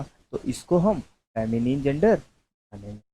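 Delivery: ordinary delivery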